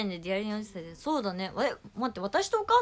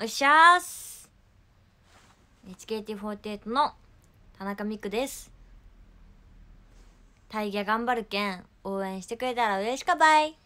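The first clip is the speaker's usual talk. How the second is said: a hint of Kumamoto dialect, greeting louder; 5 dB louder than usual. Shown as a demonstration. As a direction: introduce yourself lightly in Kumamoto dialect. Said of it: "熊本弁で自己紹介お願いします。". (熊本弁で軽く自己紹介)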